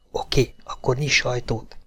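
hushed whisper, barely voiced. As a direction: breathe it so nobody outside hears. Vandam hiding, telling Ellen quietly. (suttogva, hangtalanul)

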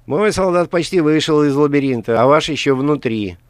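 sly, hissing gloating villain; mustache twirling villain. A narrator's reading. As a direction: gloat with a snide hiss. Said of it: "ехидно прошипел Каргантус".

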